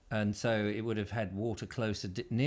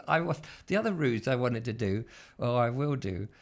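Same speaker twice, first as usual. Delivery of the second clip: ordinary delivery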